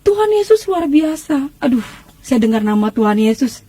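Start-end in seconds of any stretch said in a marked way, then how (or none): 0.0-1.5 exclaiming praise. Retold or imitated. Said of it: "dia langsung teriak di situ".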